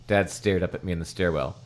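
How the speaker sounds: ordinary delivery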